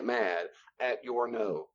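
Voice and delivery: usual speaking voice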